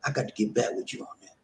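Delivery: usual speaking voice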